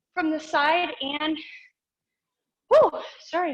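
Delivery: catching breath after exercise; breathless after a workout. Real, not acted. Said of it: "I'm catching my breath".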